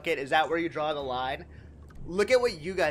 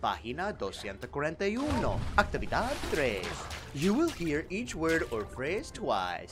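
over-enunciated language-tape voice with swooping tune; textbook audio narrator. Acted. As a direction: recite like a textbook listening exercise. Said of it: "Do the textbook bit?".

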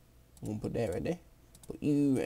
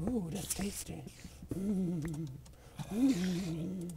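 mock savoring; 6 semitones higher, 5 dB quieter, much slower than usual. Real, not acted. Savoring found iron.

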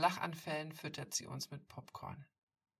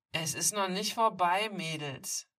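loud menacing threat; loud bully threat as he backs away; threatening; 4 semitones higher, 10 dB louder than usual. Acted. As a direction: warn them he'll be back. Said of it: "droht er".

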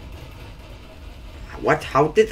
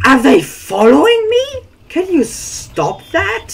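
exasperated pleading; 13 semitones higher, 6 dB louder than usual. Real, not acted. Being chased in game.

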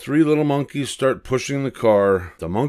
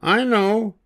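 high, bright monkey voice with an idea; little monkey's idea; 13 semitones higher, 3 dB louder than usual. Acted. One little monkey announcing a plan, high and bright. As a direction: pipe up like a clever little monkey.

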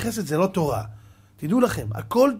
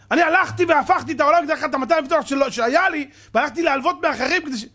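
angry complaint; 5 semitones higher than usual. Acted. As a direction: pour out your hurt at him. Angry friend recounts grievance.

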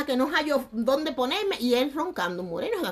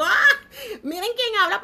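higher, louder and slow, through a laugh; laughing retort; 11 semitones higher, 5 dB louder, slower than usual. Retold or imitated. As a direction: laugh it out mockingly. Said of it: "yo me traigo la risa". (réplica burlona riéndose)

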